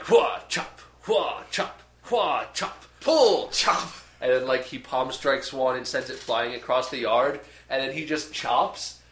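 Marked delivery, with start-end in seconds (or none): 0.0-3.9 high, loud kung fu kiai on every chop; hammed-up kung fu training shouts; martial arts grunts. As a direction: bark each chop like a training swordsman. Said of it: "And he's just like".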